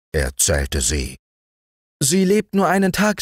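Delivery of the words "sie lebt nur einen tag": high, quick ant voice; scandalized as the ant; gossiping ant. A narrator's reading. Ant reporting.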